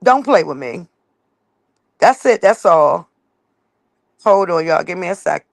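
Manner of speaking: usual speaking voice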